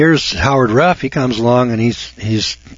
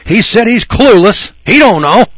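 mock gossiping listener; 10 semitones higher, 6 dB louder than usual. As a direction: ham up a yokel spreading the news. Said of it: "you can go out and say".

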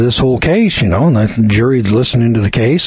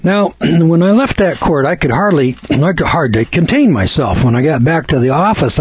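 normal talking voice

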